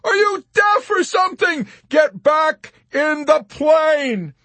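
high, loud exasperated scream; beseeching yell. A narrator's reading. Yossarian sending Arfi back in the plane, desperate yell. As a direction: yell it, dragging out the order. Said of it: "he yelped beseechingly".

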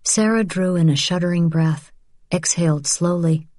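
plain reading voice

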